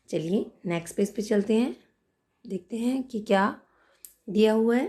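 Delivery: ordinary talk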